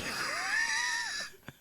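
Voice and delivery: high shrieking laugh. Real, not acted. Laughs at own story.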